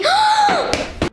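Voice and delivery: high, drawn-out gasp; shocked gasp. Acted. Niece watching her confused aunt, shocked gasp.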